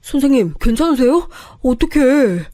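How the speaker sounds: high, anxious calling out; fretting over someone who has fallen; worried gasp at a collapsed colleague; alarmed concern. Acted. Second colleague finds teacher collapsed.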